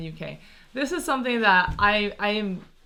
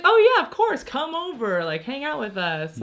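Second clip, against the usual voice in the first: higher, louder happy invite; eager welcome; 6 semitones higher, 4 dB louder than usual. Retold or imitated. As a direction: welcome an old friend back gladly. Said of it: "We're like".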